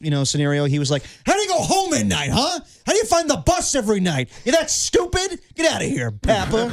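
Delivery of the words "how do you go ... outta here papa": high, mocking coach yell. Coach berating a player who can't run drills, high mock yell. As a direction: berate him like a furious coach. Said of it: "that's what he yelled at you?".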